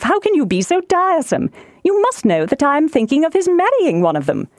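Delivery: high-pitched, fretful wifely complaint; excitable wife, exasperated. Acted. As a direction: scold a husband for teasing. Voices exasperated Mrs Bennet.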